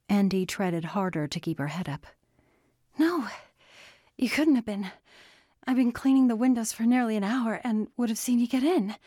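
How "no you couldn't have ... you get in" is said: high, rushed protest; insistent disbelief. A narrator's reading. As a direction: argue back, words speeding up. She argues with him in disbelief.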